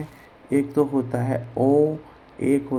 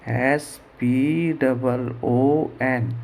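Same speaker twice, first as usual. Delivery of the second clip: very slow, letter by letter; each letter held apart; spelling out; much slower than usual. Shown as a demonstration. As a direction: spell it out slowly for a class. Teacher spells the word out.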